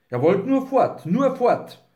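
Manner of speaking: high-pitched, needling repetition; pointed taunt. A narrator's reading. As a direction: needle them about their hurry. Wolf mocks their haste to leave.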